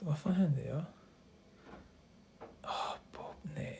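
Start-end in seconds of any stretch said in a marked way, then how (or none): 2.6-3.8 whispered, deflated groan of a fan; hushed dismay. Real, not acted. Fan watching the match go wrong, hushed dismay. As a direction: whisper it in disappointment.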